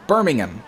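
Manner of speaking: "Birmingham" as BUR-ming-um, H dropped; UK pronunciation. Shown as a demonstration. He places you in England, "Birmingham" ending "-um". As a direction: let "Birmingham" end in a weak "um". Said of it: "if you're in the U K".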